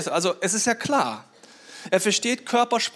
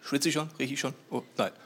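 low, softer worried self-talk; mock anxious; 6 semitones lower, 8 dB quieter than usual. Person afraid of dogs checking themselves, mock anxious. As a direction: fret under your voice. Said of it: "Wenn du Angst hast".

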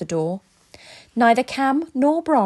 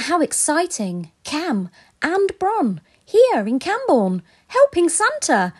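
excited; 7 semitones higher than usual. Real, not acted. Host excited outro.

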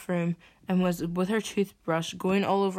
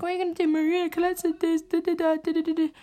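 high, yammering mimicry; mocking sister; 11 semitones higher, 4 dB louder than usual. Sister repeating herself at her sibling, high mocking yammer. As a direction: parody her nagging in a high sing-song run. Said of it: "And she's like".

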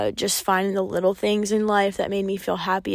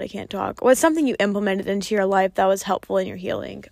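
usual speaking voice

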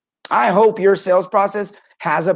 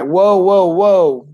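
sudden loud whoa; 6 dB louder, much slower than usual. Real, not acted. Cuts off his own rant.

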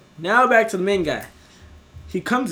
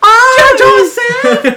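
high, loud comic wail; mock scolding shout; 16 dB louder, much slower than usual. [name] finding out from the podcast, mock scolding shout. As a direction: shriek it like a scandalized scolder.

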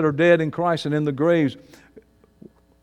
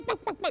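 mocking wah; 11 dB quieter, much faster than usual. Mocking a chicken.

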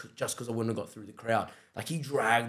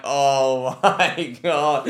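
laughing; 2 semitones higher, 8 dB louder, much slower than usual. Retold or imitated. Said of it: "I'm like".

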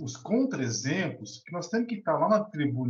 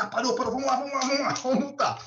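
higher, gleeful mock guard rubbing his hands; eager traffic guard; 7 semitones higher, faster than usual. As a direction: imitate the guard pouncing on a parked car. Guard eager to write a fine.

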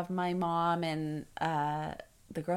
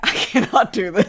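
much louder, strained refusal; answering the nurse, desperate; desperate protest; 2 semitones higher, 13 dB louder than usual. Retold or imitated. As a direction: refuse in despair. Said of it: "I was like".

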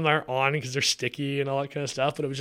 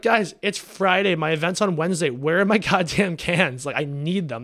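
angry complaint; 5 semitones higher, 6 dB louder than usual. Retold or imitated. Retelling a fight with the can supplier, furious. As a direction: let the frustration boil over on every word. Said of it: "I'm the most frustrated I've probably been in years".

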